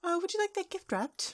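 high, suddenly obliging shopkeeper voice; eager shopkeeper. Acted. Shopkeeper to rich customer, high and eager. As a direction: switch to a high-pitched sales voice.

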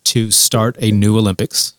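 ordinary talk

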